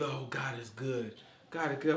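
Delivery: slow, soft pious greeting; a hint of a pious stranger; mock churchgoer greeting. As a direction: say it like a sweet churchgoer. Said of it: "You bump into people".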